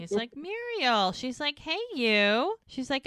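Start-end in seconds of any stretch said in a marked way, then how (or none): 0.4-1.1 lightly voiced happy surprise, higher; mild raised greeting. Retold greeting, pitch up. 1.6-2.6 a hint of a cheerful woman, higher; lightly voiced Muriel. Voicing Muriel in retelling.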